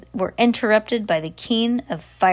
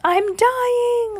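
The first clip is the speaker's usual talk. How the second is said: much higher, louder theatrical wail; melodramatic wail; 4 dB louder than usual. Acted. Sarah wailing she is dying in the water, hammed-up wail. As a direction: ham up dying. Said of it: "Sarah cried".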